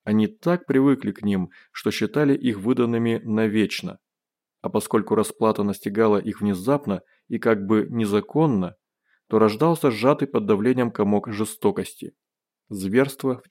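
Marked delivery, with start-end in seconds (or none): none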